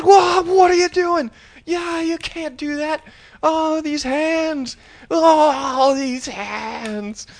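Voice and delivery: high, loud, hammed-up hysteria; freaking out. Piano teacher horrified by his pupil's nail-biting, hysterical shrieking. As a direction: shriek in horror, wailing out the last words. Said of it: "he fucking freaks out".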